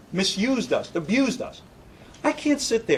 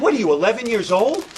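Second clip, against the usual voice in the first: higher, louder mocking jab; incredulous put-down, raised and loud; scornful retort; 6 semitones higher, 5 dB louder than usual. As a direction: sneer at him like he's a child. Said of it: "I said".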